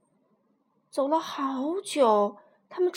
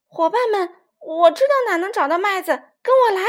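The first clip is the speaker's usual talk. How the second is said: loud, high rallying shout to friends; excited leader's shout; 7 semitones higher, 9 dB louder than usual. Acted. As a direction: call the gang to follow you. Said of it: "小胖墩儿喊道".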